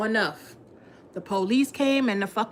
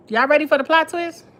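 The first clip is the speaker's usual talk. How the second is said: normal talking voice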